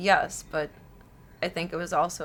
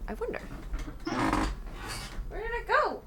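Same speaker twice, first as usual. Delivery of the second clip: high sing-song; 11 semitones higher, 5 dB quieter than usual. Pretend. Sing-song searching for fan.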